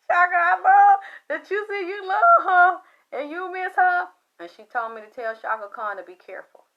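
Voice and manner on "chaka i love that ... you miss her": high, loud exclamation after a laugh; loud, high-pitched animated delivery; emphatic promise. Retold or imitated. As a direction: retell it as a big eager assurance. Playful promise to pass message.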